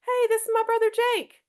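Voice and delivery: high, bright casual hello; casual introduction. Shown as a demonstration. Teen introduces brother to youth group friends, high casual hello. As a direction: greet your youth group friends, easygoing and light. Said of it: "and you say".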